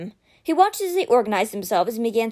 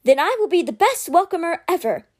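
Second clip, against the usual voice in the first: high, eager little voice; excited small creature, pitched up; perked up; 7 semitones higher, 4 dB louder than usual. A narrator's reading. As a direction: burst out brightly with new pride. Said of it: "Fox perked up".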